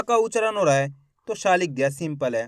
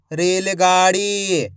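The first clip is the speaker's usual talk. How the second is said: रेलगाड़ी stretched out slowly as one word; slow blended reading of रेलगाड़ी; 2 semitones lower, much slower than usual. Shown as a demonstration. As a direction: draw out रेलगाड़ी slowly, joining the syllables. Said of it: "एक एक अक्षर का उच्चारण करके".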